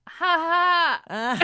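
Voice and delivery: sheepish fake laugh. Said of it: "the guy just goes".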